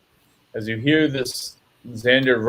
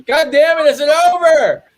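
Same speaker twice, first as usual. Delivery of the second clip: high, loud panicked cry; panicked dwarf; 7 dB louder than usual. Acted. Dwarf rolling on the ground screams in panic, high and loud. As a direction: cry out in terror, hands over ears. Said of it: "rolling around, going".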